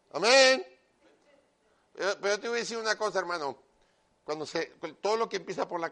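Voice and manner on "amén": amen shouted high with a rising end; preacher's rising shout for amen; loud amen. Real, not acted. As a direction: call the amen out to the whole room. Preacher calls for congregation's amen.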